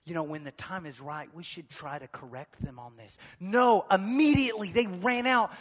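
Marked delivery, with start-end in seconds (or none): 0.0-3.2 much softer, sheepish mumble; mock awkward hush. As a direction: duck the problem under your breath. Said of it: "they didn't say".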